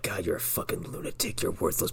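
whispered, venomous insult; hissed whisper. Retold or imitated. Dad berating Julie under his breath: venomous whisper. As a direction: hiss it under your breath with contempt. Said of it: "where he's like".

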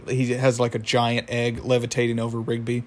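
ordinary talk

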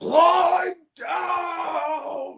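high drawn-out mock shout; stretched, high-pitched yell. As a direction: call it out long and high. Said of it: "then you be shouting".

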